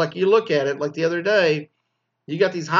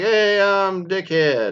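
dopey graduate intro; 6 semitones higher, 4 dB louder, much slower than usual. Retold or imitated. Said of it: "you go up there and like".

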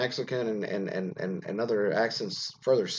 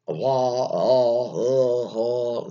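mocking drawled accent with unfinished consonants; drawn-out open vowels, imitating an accent that drops word endings; 5 dB louder, much slower than usual. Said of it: "a woman in my life that spoke".

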